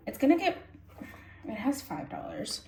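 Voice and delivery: ordinary talk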